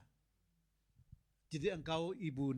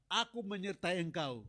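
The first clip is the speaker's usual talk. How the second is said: loud, rising proclamation of God's promise; preaching God's words; 5 semitones higher, 5 dB louder than usual. Acted. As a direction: build it up and proclaim it. Preaching God's words at full force. (seruan khotbah yang lantang dan berapi-api)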